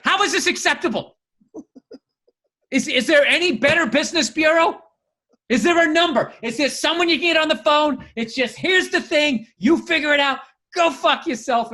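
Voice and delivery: high-pitched, indignant rant; mock meltdown. Husband rants at wife over swing instructions, mock meltdown. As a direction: escalate into hammed-up consumer outrage. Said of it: "like, a meltdown".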